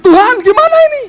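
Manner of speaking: desperate plea. Retold or imitated. Israelites begging God after defeat, high frantic plea. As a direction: cry out to God in panic.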